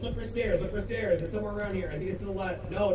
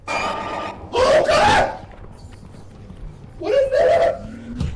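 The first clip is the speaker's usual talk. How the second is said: high shrieking panic; frightened scream; 4 dB louder, much slower than usual. Real, not acted. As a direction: scream in terror. Monster appears in game.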